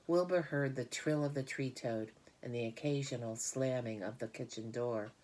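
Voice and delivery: plain reading voice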